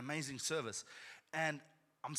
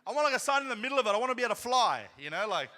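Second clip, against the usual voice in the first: high, loud, laughing disbelief; mock the idea of such a sign; laughing outrage from the pulpit; incredulous preaching; 8 semitones higher, 13 dB louder, faster than usual. Real, not acted.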